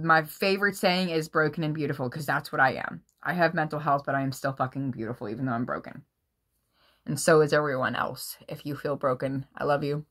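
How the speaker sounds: usual speaking voice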